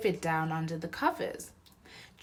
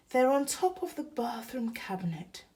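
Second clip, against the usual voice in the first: faint, breathless whisper; weak whisper; 6 semitones higher than usual. Acted. Exhausted mum answering her son, weak whisper. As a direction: barely get the words out. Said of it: "said Missus Large weakly".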